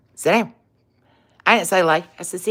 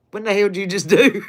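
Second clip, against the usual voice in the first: fast, stunned what the hell; mock astonishment; faster than usual. Dog jumped the fence, owner stunned: fast astonished blurt. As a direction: caught off guard, blurt it out fast. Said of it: "And I'm like".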